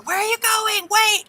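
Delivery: high mock child's cry; kid calling after parents. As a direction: call out like a kid who caught them. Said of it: "they're going".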